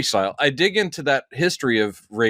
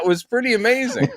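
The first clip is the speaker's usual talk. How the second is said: ordinary talk, nothing marked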